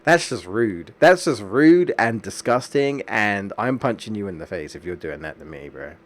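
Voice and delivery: ordinary talk, nothing marked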